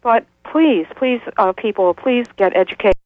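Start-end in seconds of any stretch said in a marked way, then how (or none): none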